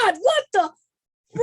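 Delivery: loud, high mock-American exclamation; high, loud California parody; mock California accent. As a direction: burst out in a hammed-up Californian accent. Joins in mocking California talk.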